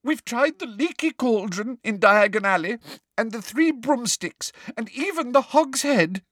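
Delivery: high, upset schoolgirl; a tearful girl pleading; girl pretending distress to a teacher; Hermione voice. A narrator's reading.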